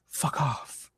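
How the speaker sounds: hushed, breathy fuck off; whispered fuck off. Real, not acted. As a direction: whisper it like a final dismissal. Fed up, repeats it hushed.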